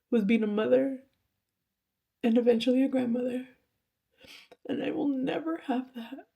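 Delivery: fighting back tears as she speaks; choked-up confession of lost motherhood; tearful. Real, not acted. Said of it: "it's very emotional for me".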